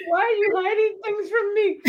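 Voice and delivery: high, loud hammed-up accusing wail; mock betrayal. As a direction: play-act being wronged, laughing. Mock accusation over tasty apple.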